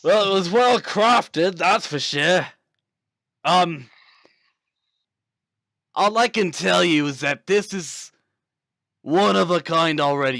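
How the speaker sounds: put-on Australian accent. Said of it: "Why is this fucker Australian now?".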